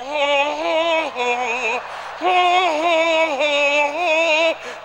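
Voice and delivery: humming while eating. Retold or imitated.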